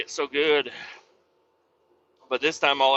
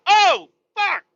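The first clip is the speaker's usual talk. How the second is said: high, loud yelping curses; squealing curse when oil splatters; pained yelp; 6 dB louder, much slower than usual. Retold or imitated. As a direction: yelp as hot oil hits bare skin. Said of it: "I'm like".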